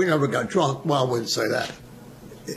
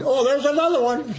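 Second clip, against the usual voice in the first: gawking; 10 semitones higher than usual. Retold or imitated. Soldiers gawking at buzz bombs.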